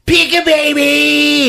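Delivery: very high, loud, drawn-out shriek; sudden hammed-up wail; mock monster scream. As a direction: screech it out slowly.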